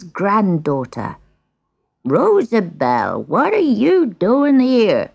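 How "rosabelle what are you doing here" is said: put-on high, slow old man's shout. A narrator's reading. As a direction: call out sharply as the old man. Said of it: "shouted the old man".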